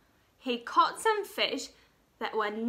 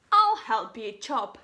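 hungry king; 7 dB louder than usual. Acted. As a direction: boom it out like a big kind king. Hungry king offers help.

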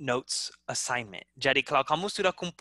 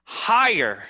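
louder lift on the word; first step up, louder; higher; 6 dB louder than usual. Shown as a demonstration. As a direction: raise it a step. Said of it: "go louder".

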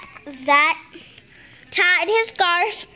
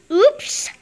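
surprised oops; 5 semitones higher, 3 dB louder than usual. Acted. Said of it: "cried Froggy".

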